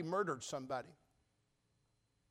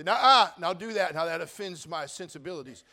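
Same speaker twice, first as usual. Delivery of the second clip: louder indignant objection; offended protest; 10 dB louder than usual. Pretend. Offended churchgoer objecting to the pastor, louder. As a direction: push back, offended.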